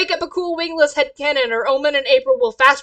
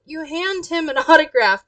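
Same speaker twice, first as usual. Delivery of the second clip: ordinary talk, nothing marked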